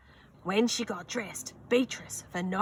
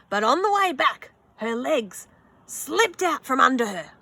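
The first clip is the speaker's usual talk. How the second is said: dramatic suspense; 5 semitones higher, 8 dB louder than usual. A narrator's reading. Sudden slip in story.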